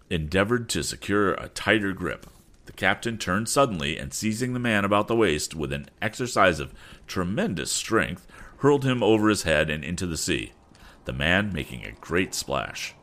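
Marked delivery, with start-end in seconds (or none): none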